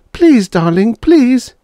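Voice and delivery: very high, loud plea; pleading. A narrator's reading. Wife begs her husband to go, pleading. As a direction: beg him, rising on the last word.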